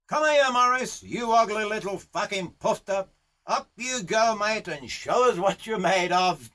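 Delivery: high, called-out taunting summons; rough boss goading a novice; bossy Aussie horseman. Acted. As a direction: order the next rider up. Boss summons the next rider.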